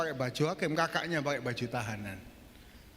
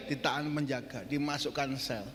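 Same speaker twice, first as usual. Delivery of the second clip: usual speaking voice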